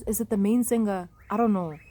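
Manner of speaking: normal talking voice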